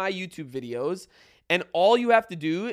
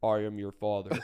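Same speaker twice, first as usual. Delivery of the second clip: Darth Vader voice; 9 semitones lower, 4 dB quieter than usual. Retold or imitated. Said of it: "bass in my voice".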